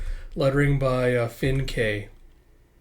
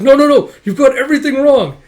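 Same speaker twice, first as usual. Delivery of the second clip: very loud, high flustered protest; frantic denial; 12 semitones higher, 13 dB louder than usual. Acted. Boy denying that she is his mother, frantic. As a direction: shout down a misunderstanding.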